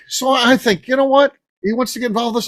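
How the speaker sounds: normal talking voice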